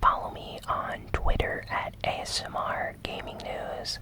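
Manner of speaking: ordinary delivery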